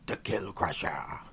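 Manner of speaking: half-hearted orc growl; lightly voiced orc warlord. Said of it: "too early for me to be doing my orc voice".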